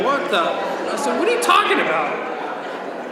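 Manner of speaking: high pitched, incredulous squeak; high disbelief. Retold or imitated. Roaster shrieking at Pete about the breakup reversal, high disbelief. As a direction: shriek your confusion at him.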